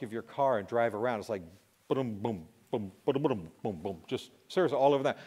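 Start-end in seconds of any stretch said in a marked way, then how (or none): 1.9-4.0 booms tumbling unevenly, like bowling balls rolling in a trunk; uneven, clattering heartbeat booms; irregular heartbeat sounds. Shown as a demonstration. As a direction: make the beat lurch and stumble. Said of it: "three bowling balls in the trunk".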